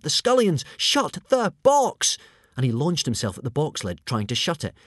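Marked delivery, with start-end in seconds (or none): 0.0-2.2 high, loud panicked shout; frantic raised-voice cry. A narrator's reading. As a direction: yell the warning high and urgent. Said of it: "shouted Hiccup".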